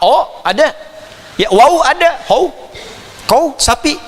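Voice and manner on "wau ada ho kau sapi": ordinary delivery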